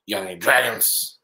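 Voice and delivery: raspy lady. Retold or imitated. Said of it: "the raspiness goes away".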